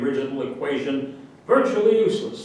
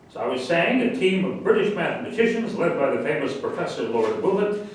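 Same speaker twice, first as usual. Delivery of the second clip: ordinary talk, nothing marked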